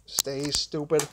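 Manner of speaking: scolding the charger, slow and muttered; annoyed mutter. Real, not acted. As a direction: drag it out under your breath like a scolding. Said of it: "I'm getting kinda mad".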